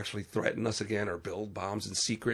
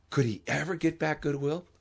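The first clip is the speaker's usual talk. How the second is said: usual speaking voice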